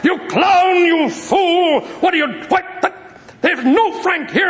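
high-pitched mock furious yelling; acted scream. Man screams at prank caller, high enraged shout. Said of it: "A guy screams".

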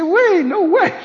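high, loud shocked cry; Peter shocked. Acted. Peter refusing the vision's command, shocked outcry. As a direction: recoil in disbelief. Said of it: "He said".

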